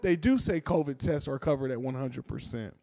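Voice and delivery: usual speaking voice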